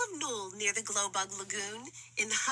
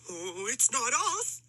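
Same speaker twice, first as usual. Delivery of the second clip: a lightly voiced monkey, a bit whiny; a hint of frightened monkeys. A narrator's reading. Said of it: "said the monkeys".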